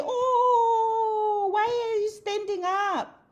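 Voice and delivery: high, mocking voice of nosy people; mocking critics. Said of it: "they'll say".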